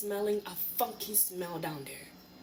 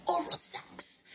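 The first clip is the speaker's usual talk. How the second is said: whispered; 10 semitones higher, slower than usual. Real, not acted. Embarrassed aside about the infection.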